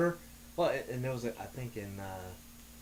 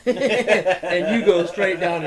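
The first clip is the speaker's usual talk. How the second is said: laughing; 7 semitones higher, 12 dB louder, much slower than usual. Real, not acted. Laughing while describing the drop.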